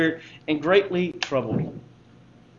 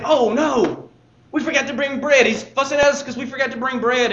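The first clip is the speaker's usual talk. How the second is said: anxious disciples; 6 semitones higher than usual. Acted. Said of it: "And they say".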